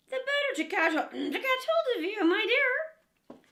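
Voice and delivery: high, loud false granny voice; wolf in grandmother's bed answering the girl. Acted. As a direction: sweet-talk her in a fake old voice.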